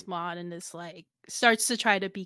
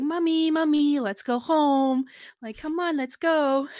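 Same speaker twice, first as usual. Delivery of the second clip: put-on higher, sweet childlike plea, slowed; pitched-up babyish whining, breaking into a laugh; cutesy pleading; 6 semitones higher than usual. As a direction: coax like a cute little kid begging to go home. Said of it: "she starts to cutely go like".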